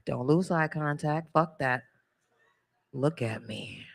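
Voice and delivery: slow, hushed, ending in a whisper; slow soft urging. Retold or imitated. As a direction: coax the screen, dropping to a whisper. High porn-watching whisper.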